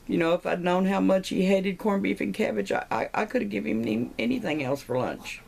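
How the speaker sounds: high-pitched pretend wail of regret; lightly acted weepy lament; mock crying. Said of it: "The Irish wife is crying".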